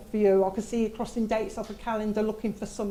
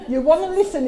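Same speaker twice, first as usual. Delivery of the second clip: high, louder laughing quote; 6 semitones higher, 7 dB louder than usual. Retold or imitated. Dad telling everybody to listen, said laughing. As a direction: tell everyone, giggling. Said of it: "He's just gone to everybody".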